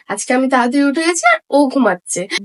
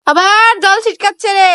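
scolding; 7 semitones higher, 4 dB louder than usual. Real, not acted. Scolding again, water splashing.

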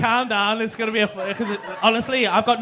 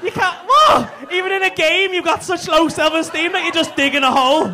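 louder and higher, slowed disbelief; incredulous comic shout; mock outraged shout; 6 semitones higher, 6 dB louder than usual. Real, not acted. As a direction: rant at him in fake outrage. Outraged at Jack being a pleb.

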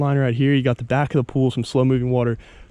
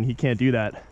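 usual speaking voice

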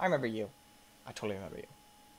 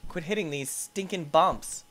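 ordinary delivery

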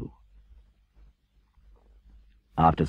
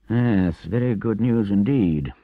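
doubtful Rat, higher and louder; dubious; 6 semitones higher, 5 dB louder, slower than usual. A narrator's reading. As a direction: agree while not quite believing it. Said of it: "observed the Rat dubiously".

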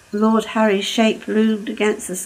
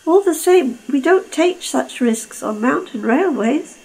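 higher, firm warning; stern Caldy; 6 semitones higher than usual. Acted. Caldy warns Lord Harry firmly, pitched up. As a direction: lay down the rule.

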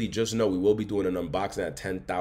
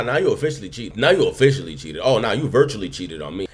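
ordinary delivery

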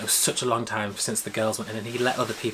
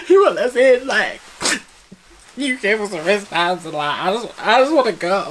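much higher, much louder whine; whiny distress; 9 semitones higher, 10 dB louder than usual. Retold or imitated. Fan complaining to the wristband guy, whining. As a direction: wail like about to cry. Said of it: "reality was like".

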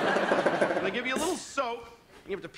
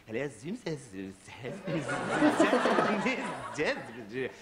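mock fancy hotel operator; 8 semitones lower than usual. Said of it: "This is how they answer the phone".

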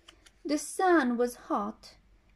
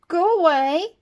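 rude dismissal; 6 semitones higher, 9 dB louder than usual. Acted. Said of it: "No need to be rude".

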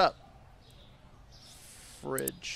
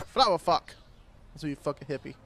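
usual speaking voice

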